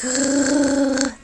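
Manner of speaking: high cooing like an infant; baby cooing sound. Shown as a demonstration. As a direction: coo like a six-week-old baby. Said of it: "they make that kind of sound".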